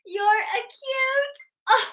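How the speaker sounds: very high, drawn-out coo; mom angle cooing. Acted. Mom angle to the baby angle in a joke, high cooing. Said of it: "What did the mom angle say".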